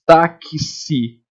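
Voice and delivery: "táxi" split as ta-ki-si; slow broken-up "táxi", ta-ki-si; k plus s. Shown as a demonstration. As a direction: separate the k and s in "táxi". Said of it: "porque ele faz".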